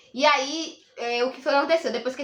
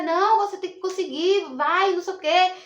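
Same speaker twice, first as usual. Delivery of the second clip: imitated encouragement, pitched up; urging on; 6 semitones higher, 3 dB louder than usual. Partner cheering her through labour, imitated urging. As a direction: push them to keep going, as retold.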